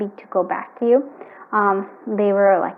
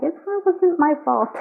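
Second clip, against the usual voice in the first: mock whiny quote; 7 semitones higher than usual. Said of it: "is what they're saying".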